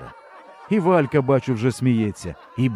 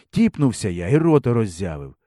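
usual speaking voice

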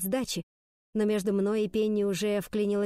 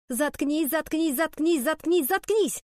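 higher, furious rapid-fire repetition; jackhammer rattle; 7 semitones higher, 3 dB louder, slower than usual. A narrator's reading. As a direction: hammer it out, building louder and higher. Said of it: "зачастила, как отбойный молоток". (тараторя, как отбойный молоток)

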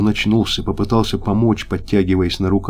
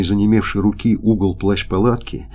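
usual speaking voice

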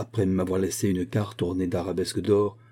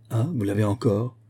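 ordinary delivery

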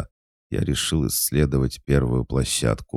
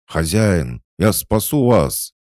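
heroic shout; 8 semitones higher, 6 dB louder, slower than usual. Acted. Owl rushes to rescue master.

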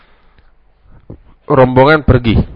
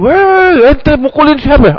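much higher, startled yelling; shocked outcry; 14 semitones higher, 3 dB louder, slower than usual. Retold or imitated. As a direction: shout out in alarm at the beating. Mosque crowd sees man beaten.

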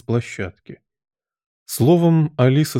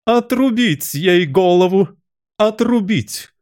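much higher, drawn-out royal scream; furious yell; 9 semitones higher, 3 dB louder, slower than usual. A narrator's reading. Enraged Queen ordering Alice's execution, yelling. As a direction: shriek the order in rage. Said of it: "завопила во весь голос".

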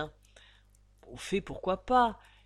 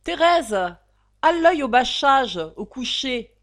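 loud, high command; 6 semitones higher, 11 dB louder, slower than usual. Acted. Mother Chopin calling Thérèse to bed, loud and high.

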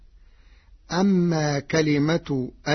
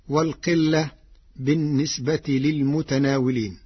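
normal talking voice

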